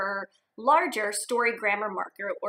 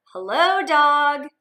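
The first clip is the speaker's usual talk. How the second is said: slow, louder friendly hello; drawn-out hello; 4 semitones higher, 8 dB louder, much slower than usual. Acted. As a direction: stretch out a hello to the dog. Duck greets animals cheerfully.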